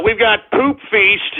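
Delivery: normal talking voice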